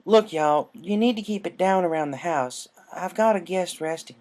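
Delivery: stern country warning; keep-it-down big sister; firm Applejack. Acted.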